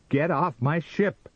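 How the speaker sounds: high, loud, forceful command; angry order. A narrator's reading. Sam orders the vision off.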